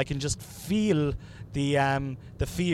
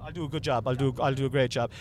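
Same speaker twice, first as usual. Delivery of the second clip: usual speaking voice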